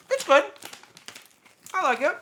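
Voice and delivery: talking while chewing; words round a bite of food; mouth full. Real, not acted. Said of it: "trying not to talk with my mouth open".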